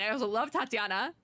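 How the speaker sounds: normal talking voice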